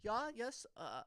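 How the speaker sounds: high, singsong attempt at German; botched German accent drifting into Swedish; Swedish-sounding German accent. Trying out a German philosopher for a friend, Swedish-sounding accent. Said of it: "Nope, that sounds Swedish".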